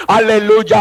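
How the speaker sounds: ordinary delivery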